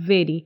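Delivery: very, a light vibration on the first sound. Shown as a demonstration. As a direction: model very with lip on upper teeth. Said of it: "vibration".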